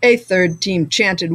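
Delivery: ordinary delivery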